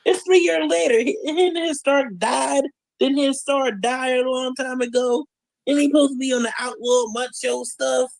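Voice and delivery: lightly voiced fan chatter; mock fans. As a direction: play fans sneering at his past. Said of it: "some people be like".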